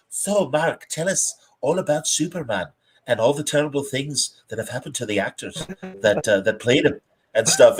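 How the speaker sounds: soft, put-on British TV host voice; morning TV presenter. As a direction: imitate a morning-show interviewer, softly. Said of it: "Good Morning Britain".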